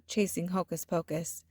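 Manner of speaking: ordinary delivery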